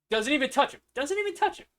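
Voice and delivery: high, loud hype over a highlight; rising thrill as the play unfolds; excited. Real, not acted. Hyping the highlight juke.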